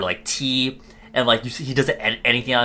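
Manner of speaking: normal talking voice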